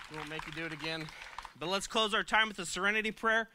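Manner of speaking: ordinary delivery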